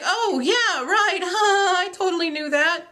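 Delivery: high, awkward cover-up with a fake laugh; feigned knowing. Teacher covering up to colleague, feigned knowing. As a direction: bluff that it was known all along. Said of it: "as if I knew it".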